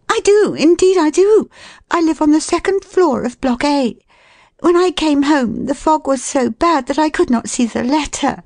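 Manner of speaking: very high, insistent woman's voice; flustered, earnest lady. Acted. Woman assuring the man she lives here, flustered. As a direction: insist earnestly, pitched right up.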